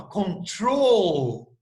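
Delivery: "control" with a long, drawn-out second syllable; final-syllable stress. Shown as a demonstration. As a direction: stretch the TROL in "control". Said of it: "E oxítono, ó". ("control" com a última sílaba tônica, bem alongada)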